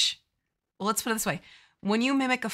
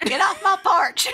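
high, loud cranky granny shout; granny yelling; 11 semitones higher, 8 dB louder than usual. Pretend. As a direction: holler like a grumpy old granny.

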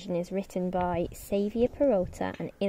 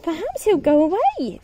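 first pig hoping; 8 semitones higher, 4 dB louder than usual. Acted. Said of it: "said the first pig".

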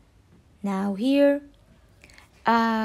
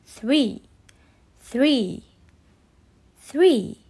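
three modeled slowly, the last one louder; teaching a child to count; 5 dB louder, much slower than usual. Shown as a demonstration. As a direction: drill three for the kids to copy. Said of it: "Repeat after me".

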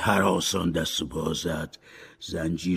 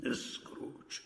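breathy whisper of the name; ghostly whisper; 18 dB quieter than usual. Acted. Ghost Marley whispers Scrooge's name eerily. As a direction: hush it like a spirit.

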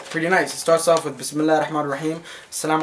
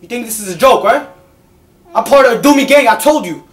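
higher, louder playful bravado; mock tough talk; 6 semitones higher, 7 dB louder than usual. Mom playing tough with her baby, high and loud.

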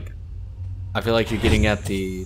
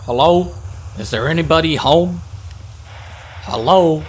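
loud raised call into emptiness; calling down a hallway; 7 semitones higher, 6 dB louder than usual. Real, not acted. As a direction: call out to an empty building. Calling into an empty base.

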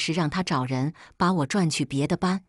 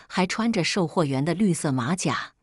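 ordinary delivery

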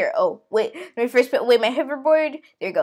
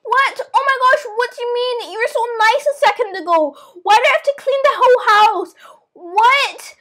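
higher, indignant disbelief; shocked protest; 5 semitones higher, 5 dB louder than usual. Pretend. Child stunned that nice babysitter turned mean, shocked protest.

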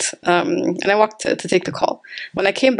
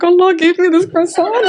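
higher, louder astonished joy; thrilled exclaim; 9 semitones higher, 4 dB louder than usual. Retold or imitated. Preacher reliving her amazement at the free croissant, higher and louder. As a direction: marvel out loud at the gift. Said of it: "Like, I was like".

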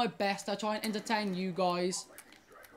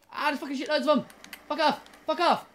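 higher and louder, yelling in real frustration; real frustrated shout; 6 semitones higher, 5 dB louder, faster than usual. Real, not acted. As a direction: shout it out as the enemies swarm you. Swarmed by enemies, swearing.

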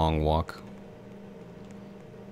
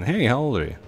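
higher, louder quoted question; imitating curious viewers; 6 semitones higher, 5 dB louder than usual. Player quoting viewers' question, lightly imitated curiosity. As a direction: pipe up like a viewer asking. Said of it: "A lot of you were asking".

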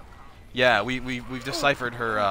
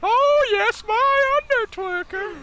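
a much higher, drawn-out lecherous boss voice; Vince McMahon impression; slower than usual. Streamer reading a donation as the wrestling boss, in a much higher put-on voice. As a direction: ham up a leering wrestling boss. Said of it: "the next donation will get a Vince McMahon".

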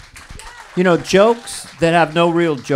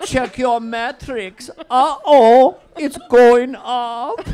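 slow, drawn-out comic pitchman, building louder; ad-read impression; 3 semitones higher, 6 dB louder, slower than usual. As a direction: stretch the words in a put-on ad character. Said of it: "[name] does impressions in most of the ads".

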